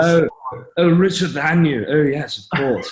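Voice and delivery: put-on S1 shows his old posh English accent on request. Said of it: "my old English accent when I used to talk like this".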